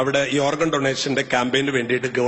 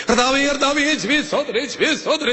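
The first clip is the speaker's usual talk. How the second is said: mock pious prayer; 14 semitones higher than usual. Preacher mocks showy believers with a high fervent prayer.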